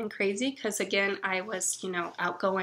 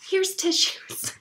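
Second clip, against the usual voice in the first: mock friend; 10 semitones higher, much slower than usual. Friend's deadpan reply.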